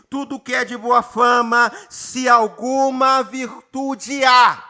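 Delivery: solemn preacher reading; slow scripture proclamation. Acted. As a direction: lean on every word as holy text. Pastor reads the final verse.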